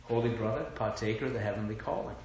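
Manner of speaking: softly imitating a friend's pious greeting; friend's scriptural greeting, hushed. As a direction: recall his greeting quietly, quickening at the end. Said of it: "But that's how he talks".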